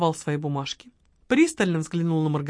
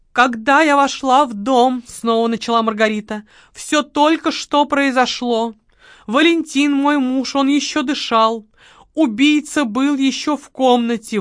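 higher, slow, mournful recital; staged sorrow in a raised voice; theatrical widow; 5 semitones higher, 6 dB louder than usual. Acted. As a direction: perform the grief for the detective. Margarita recites testimony third time.

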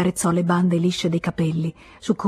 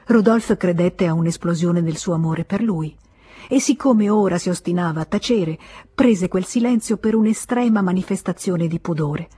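ordinary delivery, nothing marked

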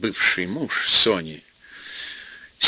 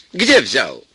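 mild demand; 4 dB louder than usual. Acted. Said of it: "вернулась и закричала".

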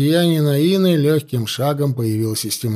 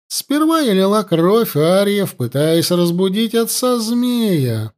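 higher-pitched, menacing priestess taunting her captive; gloating high priestess; 5 semitones higher than usual. Acted. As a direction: savour every threat like a cruel priestess. Priestess gloats over captive princess.

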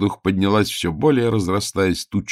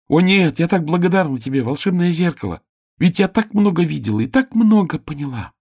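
excited; 9 semitones higher, 4 dB louder than usual. A narrator's reading. Grateful girl thanks mirror.

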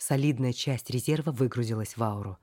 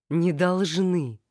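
firm objection; 4 semitones higher, 4 dB louder, much slower than usual. Acted. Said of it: "возразила я".

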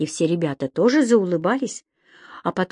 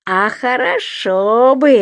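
slow, raised, gleeful exclamation; delighted; 4 semitones higher, 4 dB louder, much slower than usual. A narrator's reading. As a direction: welcome the idea with relish. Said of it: "обрадовался Субастик".

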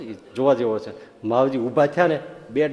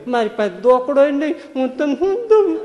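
put-on higher, wailing old mother, half laughing; mock crying mother; 11 semitones higher, 4 dB louder than usual. Old mother wails to her son: hammed-up crying. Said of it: "રોવા માંડે વાત કરી".

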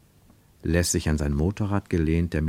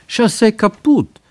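much higher and louder, slow, in a put-on foreign voice; a pushy officer mangling German, voiced high and loud; broken German militiaman; 7 dB louder, slower than usual. A narrator's reading. Militiaman insisting to the travellers, high put-on voice.

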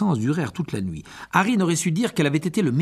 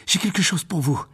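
higher, winded voice; out of breath; 5 semitones higher than usual. Acted. Harry stopping Malfoy with a gift, panting. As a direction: say it as if just run up. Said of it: "dit-il la voix haletante".

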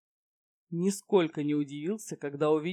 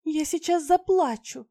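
high, pouting, fake-offended voice; pouty mock hurt; 12 semitones higher, 7 dB louder than usual. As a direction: pout it like a spoiled flirt. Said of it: "обиженно надула губки".

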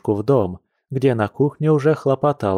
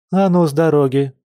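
higher, firmer order; annoyed command with raised pitch; displeased shooing; 5 semitones higher, 4 dB louder than usual. A narrator's reading. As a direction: shoo them out of the way. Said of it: "недовольно шикнула Маша".

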